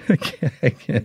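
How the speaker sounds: helplessly cracking up while trying to speak; can't keep a straight face. Real, not acted. Guest about to name his joke band, cracking up. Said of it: "can't say it with a straight face".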